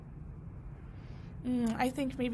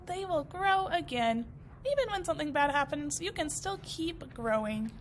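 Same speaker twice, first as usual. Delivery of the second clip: ordinary delivery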